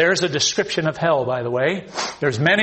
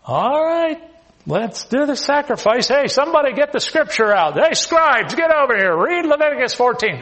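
mock eager priest; 7 semitones higher, 3 dB louder than usual. Preacher acting out excited leper scene.

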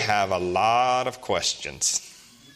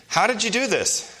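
a doctor's raised questioning voice, higher; doctor asking; 11 semitones higher than usual. Retold or imitated. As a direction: play the curious doctor, pitched up.

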